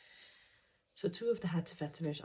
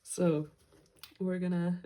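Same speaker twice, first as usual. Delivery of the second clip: smiling voice; 6 dB louder, slower than usual. Real, not acted. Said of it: "you can hear the smile in my voice".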